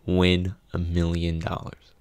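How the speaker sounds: ordinary talk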